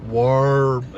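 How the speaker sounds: Warb, loud with a long-held R; effortful, overdone R. Shown as a demonstration. Husband showing what an effort the R is, overdone. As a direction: labour over the R in "Warb". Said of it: "It sounds like an awful effort".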